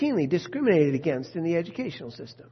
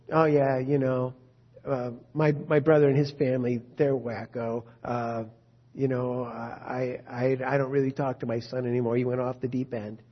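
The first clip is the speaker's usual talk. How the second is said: lower, offhand voice of a relative who gave up on his son; dismissive relative; 7 semitones lower than usual. Retold or imitated. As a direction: shrug it off like an embarrassed parent. Voicing dismissive family member.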